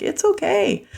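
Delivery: very high, soothing imitation; retold reassurance. Giver holding the crying stranger, reassuring. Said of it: "And I'm like".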